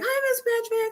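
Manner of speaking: very high sugary greeting; sweet counselor. Retold or imitated. Counselor greeting the angry mom, very high sweet voice. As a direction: chirp it like a cheery receptionist. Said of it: "guidance counselor calls me back".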